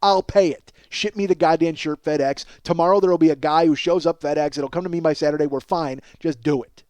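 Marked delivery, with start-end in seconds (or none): none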